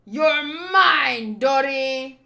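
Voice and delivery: loud, forceful cry; big combat holler; triumphant battle shout. Acted. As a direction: shout it as the winning blow lands. Giant claims victory mid-battle.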